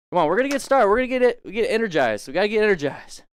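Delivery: loud, high pump-up shouting; hype shouting. Pretend. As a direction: shout to fire up the class. Pumping the class up.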